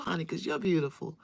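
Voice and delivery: ordinary delivery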